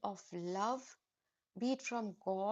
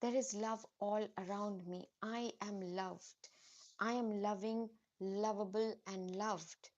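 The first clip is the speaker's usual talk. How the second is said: soft affirmation; 3 semitones lower, 3 dB quieter than usual. Acted. Speaks affirmations.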